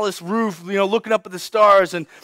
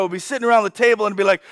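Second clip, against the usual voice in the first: ordinary talk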